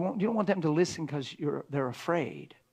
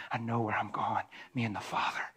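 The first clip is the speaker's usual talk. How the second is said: whisper; 5 semitones lower than usual. Acted. Repeating the line as whisper.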